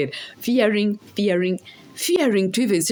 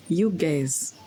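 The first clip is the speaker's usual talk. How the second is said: ordinary talk, nothing marked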